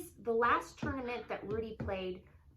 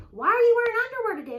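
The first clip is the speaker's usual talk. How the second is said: put-on high, loud, nosy child; loud little girl; 12 semitones higher, 14 dB louder, faster than usual. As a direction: shout it high like a curious kid. Daughter questioning mom loudly.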